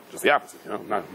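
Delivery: usual speaking voice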